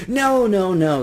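high, loud, alarmed refusal; crying out. A narrator's reading. Old man refusing the offered money, crying out. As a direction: burst out in emphatic protest. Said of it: "he cried".

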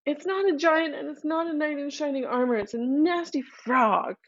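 put-on young princess voice. A narrator's reading. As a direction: speak up as a guilty little girl. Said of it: "and she says".

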